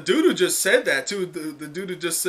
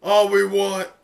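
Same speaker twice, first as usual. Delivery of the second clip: louder, higher desperate plea; pleading outburst; 5 semitones higher, 8 dB louder than usual. Acted. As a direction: beg them loudly for a break. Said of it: "she's like".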